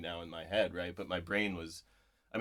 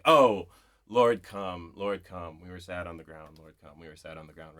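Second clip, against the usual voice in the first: poem recitation fading; 10 dB louder than usual. Shown as a demonstration. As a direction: let the prayer fade into a hush. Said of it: "she just repeats, like, the simplest utterances".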